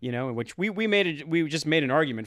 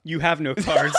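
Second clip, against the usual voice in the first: laughing; 6 dB louder than usual. Real, not acted. Mocking Europe, laughing.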